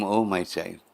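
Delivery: a hint of a loving goddess; gentle mother voice. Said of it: "The beautiful voice was saying".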